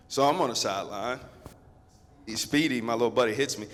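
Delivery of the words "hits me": normal talking voice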